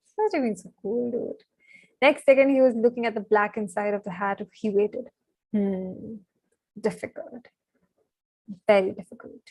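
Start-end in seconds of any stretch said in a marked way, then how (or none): 5.5-9.4 a hushed, thoughtful sorting hat, half-whispered; small voice in his ear. A narrator's reading. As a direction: murmur it into Harry's ear, weighing him up. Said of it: "said a small voice in his ear".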